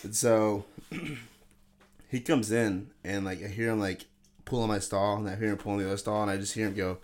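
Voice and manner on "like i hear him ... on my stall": ordinary talk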